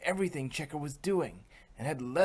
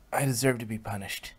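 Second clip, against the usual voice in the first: usual speaking voice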